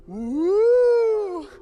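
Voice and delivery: high, loud cheer; excited whoop. Real, not acted. Host hyping the audience for the next act, loud whoop. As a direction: whip up the crowd.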